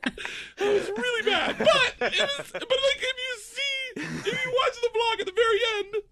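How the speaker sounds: high, laugh-broken retelling. Real, not acted. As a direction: fight through laughter at a grim memory. Laughing while recounting bad story.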